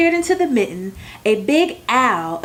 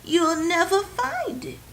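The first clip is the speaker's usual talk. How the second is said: higher, softer grandmotherly warning; 8 semitones higher, 4 dB quieter than usual. A narrator's reading. Grandmother Baba warns Nicky about losing a mitten, higher and softer. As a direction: caution him gently like a granny. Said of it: "she warned".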